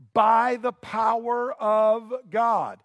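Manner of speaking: "by the power of God" slow and loud, each word weighted; emphatic preaching. Acted. Preacher drives home quoted key phrase.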